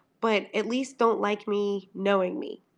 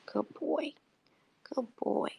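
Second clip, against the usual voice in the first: soothing pet talk; 6 dB quieter than usual. Real, not acted. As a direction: coo it gently to the dog. Soothing her sick dog.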